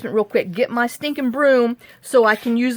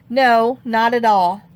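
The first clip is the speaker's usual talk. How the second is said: usual speaking voice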